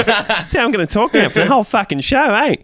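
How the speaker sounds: high, laughing put-on Australian accent; mock Aussie accent. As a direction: keep the fake Australian going through a laugh.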